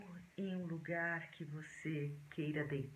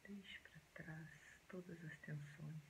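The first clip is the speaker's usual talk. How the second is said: soft, hushed meditation voice with long pauses; quiet, unhurried relaxation guidance; guided meditation; 14 dB quieter than usual. Acted.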